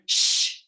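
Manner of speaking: long hushing shh like a stern librarian; forceful breathy shush. Shown as a demonstration. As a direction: shush it out like you're told off in a library. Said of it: "make a sound like you're being told off by a librarian".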